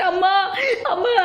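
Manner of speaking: mocking someone's fake sobbing for mother; a put-on tearful cry with a laugh behind it. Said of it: "అని ఏడుస్తావా".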